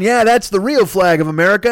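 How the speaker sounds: high-pitched mock patriotism; mocking flag-wavers. Host mocking Betsy Ross flag fans, mock fervor. Mocks flag-waving conservatives.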